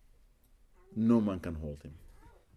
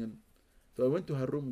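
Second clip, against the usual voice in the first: ordinary talk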